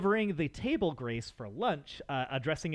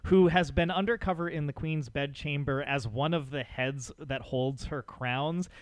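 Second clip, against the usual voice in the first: ordinary talk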